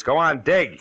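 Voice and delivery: loud, sharp command; barked order. Acted. Detective orders the crook to dig at gunpoint, loud and sharp. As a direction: bark it like you're holding the gun.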